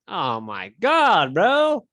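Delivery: drawn-out cry, swooping up high and loud; real sports-fan exasperation, pitch leaping on the last word; exasperated outburst. Real, not acted. Watching his team's defense fail, exasperated. Reacting to team being scored on.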